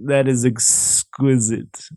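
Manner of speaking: slow, savouring, pompous interviewer voice; James Lipton. Retold or imitated. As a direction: stretch out every word like a grand host. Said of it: "doing a James Lipton voice".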